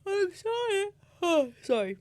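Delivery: words stretched through a yawn. Real, not acted. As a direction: talk while yawning. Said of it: "Sorry for the big yawn".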